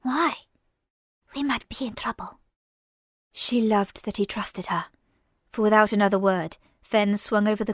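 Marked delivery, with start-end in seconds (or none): 0.0-2.4 whisper. A narrator's reading. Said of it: "she whispered".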